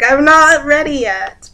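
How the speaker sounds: high, loud mock protest; acted protest. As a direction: protest loudly, voice pitched right up. Acts out protesting to grandpa.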